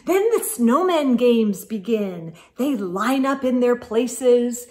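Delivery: put-on lively read-aloud to children; storybook narration. Reading a snowman story to children, lively narration. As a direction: tell it like a bedtime picture book. Reads picture book aloud.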